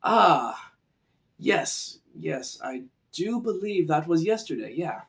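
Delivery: a light scholarly musing voice; contemplative sage. Shown as a demonstration. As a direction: muse it like a thoughtful old scholar. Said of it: "Versus something like this".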